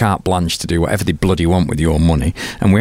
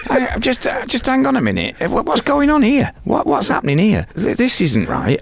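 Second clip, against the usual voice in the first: high, bewildered questioning; a musician waking up to the swindle, voice up high; puzzled suspicion. Retold or imitated. Musician asking where his money went, high and baffled.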